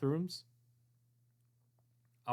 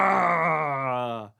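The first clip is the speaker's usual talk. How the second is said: metallic voice filter; 4 semitones higher, 8 dB louder than usual. Acted. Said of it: "echoing, reverberating, tinny sound".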